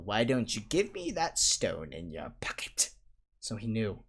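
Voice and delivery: ordinary delivery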